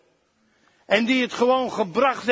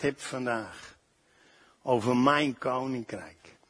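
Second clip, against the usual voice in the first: lightly voiced God, softer and lower, tender; speaking as God; 7 semitones lower, 4 dB quieter than usual. Preacher speaking God's words to his children, soft and tender. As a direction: deliver a gentle prophetic word in God's voice. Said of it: "zegt God".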